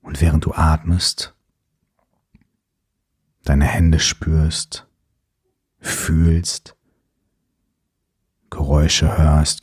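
calm, unhurried breathing cues; guided meditation. Acted.

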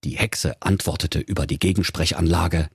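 ordinary delivery

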